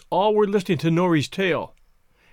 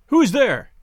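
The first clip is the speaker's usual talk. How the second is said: raised, higher-pitched challenge; a cried-out question; alarmed cry; 6 semitones higher, 4 dB louder than usual. A narrator's reading. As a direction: call out sharply to whoever is outside. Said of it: "Mapui cried".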